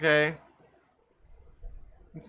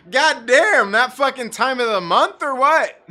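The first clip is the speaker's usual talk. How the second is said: loud, higher-pitched heckle; retold shouted jibe; 4 semitones higher, 8 dB louder than usual. Driver hollering back at the yelling woman, retold shouted jibe. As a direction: call it out loudly across the lot.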